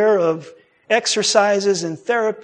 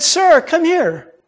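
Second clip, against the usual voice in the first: higher, drawn-out retold summons; retold calling over; 8 semitones higher, slower than usual. Nice lady at the fair to a passerby, retold calling over. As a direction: imitate someone beckoning a customer over.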